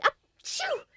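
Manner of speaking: high achoo mid-word; sneeze. Acted. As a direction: sneeze breaking into the sentence. Sneezing spell mid-sentence.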